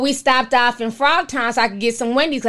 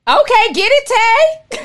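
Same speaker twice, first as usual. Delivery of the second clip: cheering on; 8 semitones higher, 4 dB louder, slower than usual. Real, not acted. Cheering on a viewer's comment.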